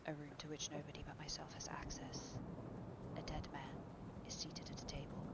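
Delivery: tense whisper of a dreadful secret; very quiet. A narrator's reading. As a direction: whisper it so no one else hears. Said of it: "said very quietly".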